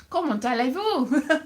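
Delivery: clumsy English-accented French; hammed-up bad French accent. Shown as a demonstration. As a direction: attempt French with a poor accent. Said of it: "I sound like a Spanish cow".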